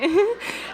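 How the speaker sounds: high rising laugh; delighted laugh. Real, not acted. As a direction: laugh with relief.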